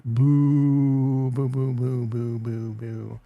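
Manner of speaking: long loud boo fading into short boos; mock booing. Booing Coinbase down a buck, mock booing. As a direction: boo it, then let it peter out.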